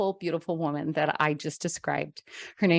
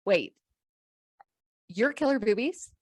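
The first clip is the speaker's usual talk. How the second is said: surprised disbelief; 8 semitones higher than usual. Retold or imitated. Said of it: "And she says".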